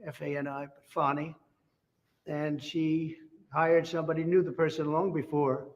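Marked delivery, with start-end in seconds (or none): 0.9-1.4 "Fani" pronounced FAH-nee; her own pronunciation. Retold or imitated. After spelling the prosecutor's name, "Fani" said as FAH-nee. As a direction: say "Fani" with ah, rhyming with Connie. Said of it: "as she would say".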